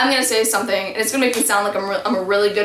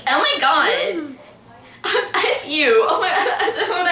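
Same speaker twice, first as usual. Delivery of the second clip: higher-pitched mock outrage, breaking into laughs; mocking outburst; 6 semitones higher than usual. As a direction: imitate a dramatic tantrum, half laughing. Imitating Joe's upset outburst.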